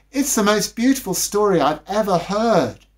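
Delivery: put-on high, earnest little girl voice; serious little girl. A narrator's reading. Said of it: "said the older little girl seriously".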